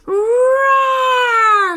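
loud drawn-out roar; werewolf roar. A narrator's reading. Werewolf roaring at litterbugs, loud long roar. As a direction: let out a big monster roar. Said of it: "she roared".

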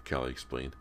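ordinary delivery, nothing marked

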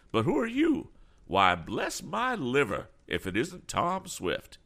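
high-pitched, drawn-out surprise; astonished cry. A narrator's reading. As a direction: exclaim in recognition, high and slow. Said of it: "he cried".

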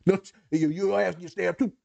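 mock threat. Playful threat voiced.

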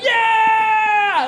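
high, loud party yell; hype yell. Retold or imitated. Comic cheering with the gaming crowd, loud yell. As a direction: roar it to the crowd.

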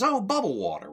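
mock Curly. Curly quote, high and loud.

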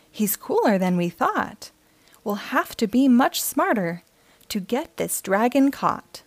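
gentle storybook read-aloud; friendly picture-book narration for kids; reading to children. A narrator's reading. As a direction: read it like bedtime story time.

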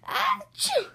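very high, loud squeaky sneeze. Acted. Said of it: "stopped sneezing".

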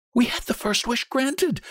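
high, feverish insistence. A narrator's reading. Old woman reasons for a second wish, feverish. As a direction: argue like one possessed by hope. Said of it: "said the old woman feverishly".

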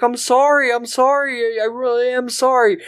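high pleading apology; mock apologetic Phil. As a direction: grovel in a silly high voice. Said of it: "he's like".

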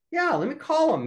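imitating the banker eagerly jumping in. Banker offers to call the seer stone owners, eager.

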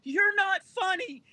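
high-pitched mock crying girl, loud at the peaks; girl stepping over the crowd while insulting the comedian, high and teary; sobbing heckler girl. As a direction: play the upset girl pushing her way out past people. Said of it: "the whole time she's like".

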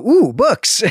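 light up at the sight of books; delighted ooh. Retold or imitated. Podcaster imitating a bookworm thrilled by scrolls. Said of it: "would go".

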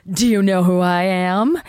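a hint of a haughty celebrity; teasing self-parody; mock diva. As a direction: lightly play the entitled star.

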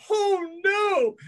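high, hammed-up dismay. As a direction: squeak it out like a panicked kid. Straitjacket ripped in half.

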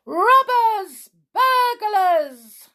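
high, full-voiced cry of alarm; drawn-out, high-pitched holler; loud alarm shout. A narrator's reading. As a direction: raise the alarm across the village. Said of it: "there was a loud shout".

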